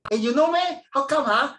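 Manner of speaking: high, loud Singlish questioning; Singlish surprise. Acted. Singaporean approached by a stranger abroad, surprised high Singlish. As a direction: act startled in broad Singlish.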